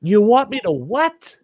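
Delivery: high, indignant-sounding retort; incredulous question. Retold or imitated. Bowler misunderstands the warning, calls back incredulous. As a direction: throw it back high and disbelieving. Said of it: "And she goes".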